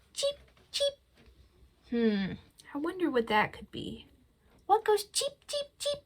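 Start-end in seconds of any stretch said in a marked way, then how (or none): 0.1-0.9 very high squeaky cheeps; chick cheep. Pretend. Librarian imitating a chick for kids, squeaky. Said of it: "It goes cheep". 4.7-6.0 very high squeaky question; chick voice. Pretend. Librarian asking kids in a chick voice, squeaky. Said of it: "asks in chick voice".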